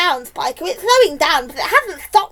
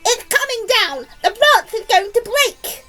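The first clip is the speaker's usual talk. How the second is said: high, loud cry of warning; alarmed shout; 10 semitones higher, 6 dB louder than usual. A narrator's reading. Aunt sees the branch giving way, alarmed shout. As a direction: shout it as if the branch is about to snap. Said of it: "Aunt Sponge shouted".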